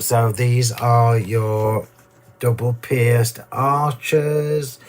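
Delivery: slow, deliberate naming. Real, not acted. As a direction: spell it out slowly. Slowing to show dies.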